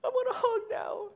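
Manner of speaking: high, loud sobbing plea; crying child. Retold or imitated. Father imitating daughter begging for a hug. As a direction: wail for a hug like a toddler.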